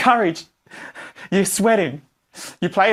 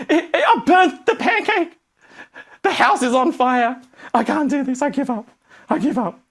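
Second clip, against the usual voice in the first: complaining beginner; 6 semitones higher than usual. Retold or imitated. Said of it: "in your opinion".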